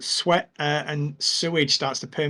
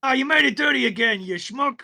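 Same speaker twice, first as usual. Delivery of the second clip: annoyed goblin; 11 semitones higher than usual. Acted. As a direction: scold him for dirtying the hand. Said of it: "Goblin, he goes".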